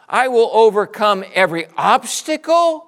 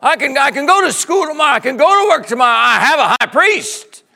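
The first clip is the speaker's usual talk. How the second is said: loud, higher, fast fervent preaching; preaching at full force; 8 semitones higher, 8 dB louder than usual. Real, not acted. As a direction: preach it with rising fire.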